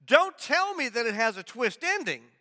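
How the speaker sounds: a lightly voiced doubting listener, high on [name]; imagined listener objecting. Pastor voicing a listener who objects to spoilers, light mock protest. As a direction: play a mildly protesting churchgoer. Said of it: "And you might say".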